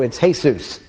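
Jesus said the Spanish way, hay-SOOS; Spanish pronunciation. Shown as a demonstration. Teaching the church how Mexico says Jesus, hay-SOOS. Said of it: "in Mexico".

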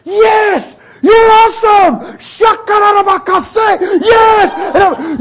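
high, full-force praise yells; wild, exultant outburst; ecstatic shouting. Retold or imitated. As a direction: scream praise while running. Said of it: "I'm running down this country road".

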